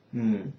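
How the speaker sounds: HMM dipping low; third tone. Shown as a demonstration. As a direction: let HMM sink low in the voice. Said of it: "The third is".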